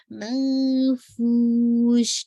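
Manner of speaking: منفوش drawn out, ش spread; slow melodic recitation. Shown as a demonstration. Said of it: "آپ کو خود فیل ہوگا".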